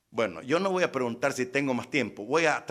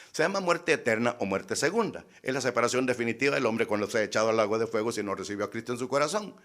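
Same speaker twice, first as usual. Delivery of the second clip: ordinary delivery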